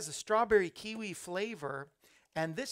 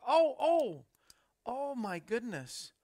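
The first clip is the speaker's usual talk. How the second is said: surprised; 6 semitones higher, 6 dB louder, slower than usual. Real, not acted. Reacting to viewer's comment.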